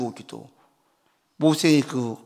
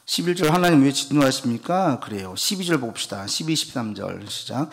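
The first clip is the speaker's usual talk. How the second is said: normal talking voice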